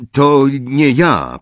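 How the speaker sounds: slow, firm and insistent; firm denial. Acted. Harry firmly insists to Hagrid it wasn't him. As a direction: plant your feet and deny it. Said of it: "powiedział stanowczo Harry".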